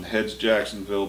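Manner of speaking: normal talking voice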